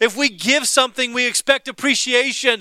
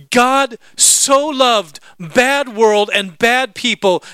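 "God" and "bad" weighed word by word, slowly and a bit louder; slow emphasis; 4 dB louder, much slower than usual. Shown as a demonstration. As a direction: spell it out slowly, hitting "bad". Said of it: "Cosmos means bad system".

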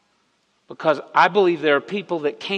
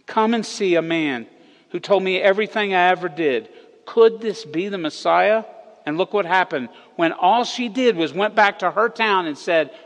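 raised, impassioned preaching voice; 4 semitones higher, 4 dB louder than usual. Acted. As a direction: preach it to the whole room, building. Climax of the sermon, preaching.

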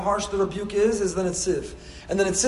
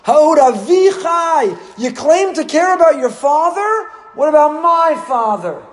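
loud, high, slow accusing shout; furious rebuke; 9 semitones higher, 11 dB louder, slower than usual. Acted. Yosef denouncing his brothers as frauds, furious slow shouting. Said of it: "the rebuke was?".

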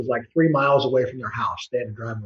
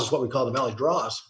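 ordinary talk

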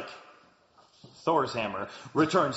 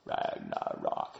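slow, deep whispered drawl; 9 dB quieter, much slower than usual. Pretend. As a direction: breathe the word out slowly. Savouring the title again.

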